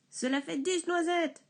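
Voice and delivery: crié plus aigu; crie, excédé; cri. Acted. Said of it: "crie le bûcheron". (le bûcheron crie, excédé)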